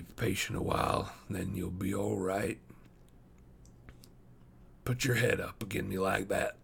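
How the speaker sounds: a hint of a parched, weary man; weary dying wanderer. A narrator's reading. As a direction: gently soothe a thirsty child, folksy and tired. Narrator voices the dying man.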